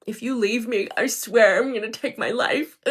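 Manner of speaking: a loud, hammed-up plea from a clingy ex, imitating him; mock the ex's desperate threat, loud on the second half; mimicking a manipulative partner's dramatic threat; 1 mock suicide threat. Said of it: "have you ever had a narcissist threaten to".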